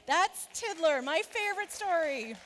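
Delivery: high, loud announcement; big storytime finish; emphatic sign-off. Real, not acted. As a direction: proclaim it proudly to the room.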